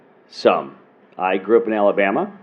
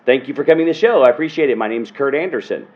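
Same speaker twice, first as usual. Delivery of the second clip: higher, projected showman's thank-you; stage voice; 4 semitones higher, faster than usual. Shown as a demonstration. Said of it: "when I'm on stage".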